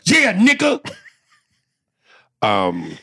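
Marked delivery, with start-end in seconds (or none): 0.1-0.9 high, loud mock yell; hammed-up, high-pitched retort; imitated attack-dog retort. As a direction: blurt it like setting a dog on someone. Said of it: "The way he said that".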